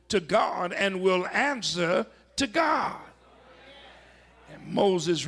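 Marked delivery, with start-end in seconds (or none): 2.4-3.0 bursts up high on the last words; sudden shout. Acted. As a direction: hit it like a thunderclap. Preacher stresses answering to God.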